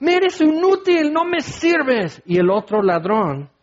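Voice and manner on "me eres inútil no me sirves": agudo y forzado, sin aliento, el final lento; agonized strained cry. Acted. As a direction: escupe la frase entre dolor. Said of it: "pero era agonía". (el ladrón en la cruz insulta a Jesús con voz de agonía)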